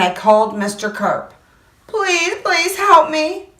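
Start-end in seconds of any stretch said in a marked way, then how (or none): 1.9-3.5 high, slow begging; pleading child. Acted. Boy phones Mister Carp, high slow plea. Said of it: "I said".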